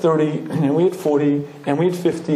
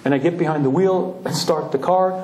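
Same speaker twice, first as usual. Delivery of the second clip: usual speaking voice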